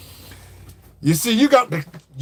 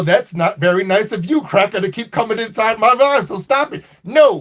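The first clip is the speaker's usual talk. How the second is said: normal talking voice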